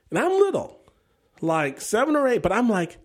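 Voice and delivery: usual speaking voice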